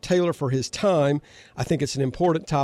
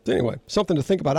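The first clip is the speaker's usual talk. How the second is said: ordinary talk, nothing marked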